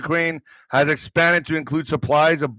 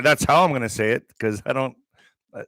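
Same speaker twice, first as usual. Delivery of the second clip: ordinary talk, nothing marked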